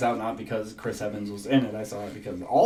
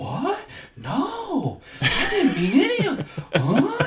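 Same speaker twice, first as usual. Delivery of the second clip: very high, baffled squeak through laughter; confused Mark Wahlberg; 14 semitones higher, slower than usual. Retold or imitated. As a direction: play him lost and bewildered, laughing. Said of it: "really bad Mark Wahlberg acting. He's like".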